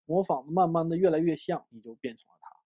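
ordinary delivery

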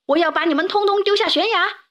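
high, forceful threat; roaring giant's threat. A narrator's reading. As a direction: threaten them with pitch driven up. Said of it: "巨人咆哮着".

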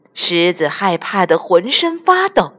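ordinary delivery

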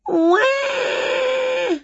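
put-on very high, long baby cry; baby wail. A narrator's reading. Winnie turned baby on the floor, high wail. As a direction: bawl like a newborn. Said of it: "went a little Winnie baby".

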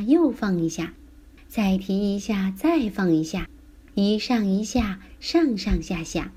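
ordinary delivery

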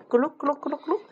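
high comic gulp-gulp sound; high gulping sound effect; gulping noise. Acted. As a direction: mimic swallowing with glee. Rats swallow the root.

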